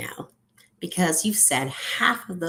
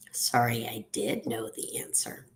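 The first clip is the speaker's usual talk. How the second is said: low, soft, sheepish admission; quiet confession under the breath; hushed apology; 6 semitones lower, 8 dB quieter than usual. Acted. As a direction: drop to a near-whisper, leaning on "did". Opposite apology, said as an aside.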